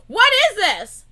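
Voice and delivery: incredulous repeated question; disbelief. Real, not acted. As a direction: ask again and again in shock. Disbelief at video.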